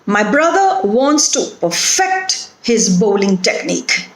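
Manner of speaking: perfect as per-FECT, the verb; weak per, strong FECT in perfect; stress on second syllable. Shown as a demonstration. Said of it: "it is the second syllable that is stressed".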